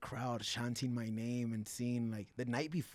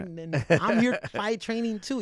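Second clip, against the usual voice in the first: raised, higher retold complaint; indignant rant; 8 semitones higher, 6 dB louder than usual. Fighter calls out promoters for ignoring him, indignant. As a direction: throw shade at the promoters, voice pitched up. Re-enacting his shade at promoters.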